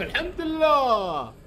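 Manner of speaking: high stretched sigh of الحمد لله; high slow exhausted relief; drawn-out relief. Real, not acted.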